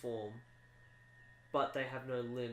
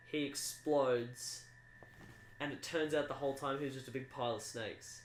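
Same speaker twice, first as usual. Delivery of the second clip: normal talking voice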